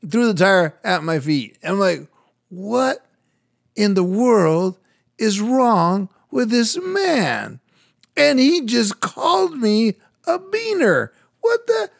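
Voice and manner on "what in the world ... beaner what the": high, drawn-out retold disbelief; blown away. Man recalling being insulted by a stranger, stunned and incredulous. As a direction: stretch each phrase upward, acting out being stunned. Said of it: "I was blown away".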